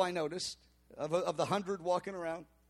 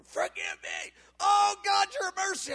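high, mock wail begging forgiveness; imitated wailing plea; 12 semitones higher, 4 dB louder than usual. Collapsed woman wails to God for forgiveness, high mock plea. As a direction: cry it out much higher, like a woman begging. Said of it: "crying out that Jesus would forgive her".

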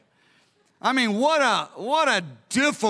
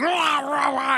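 faked madness; 6 semitones higher, slower than usual. David acting crazy before the king, wild roaring. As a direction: roar nonsense like a badly faked madman. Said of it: "He faked madness".

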